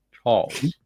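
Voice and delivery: slightly posh English "Charles". Shown as a demonstration. Host showing how they can't help saying Charles, slightly posh. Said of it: "say Charles in a slightly posh voice".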